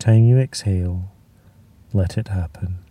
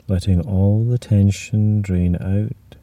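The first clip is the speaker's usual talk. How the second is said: ordinary talk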